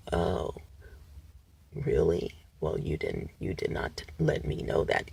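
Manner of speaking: deadpan drone with no melody; monotone voice. Shown as a demonstration. Imitating the Exorcist actor for viewers, flat monotone. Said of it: "monotone, just like this".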